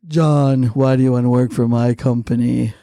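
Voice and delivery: very low-pitched invented character voice; made-up character. Retold or imitated. Comic stumping the host, deep invented character voice. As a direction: drop it deep for a mystery character. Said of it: "I just made up a character".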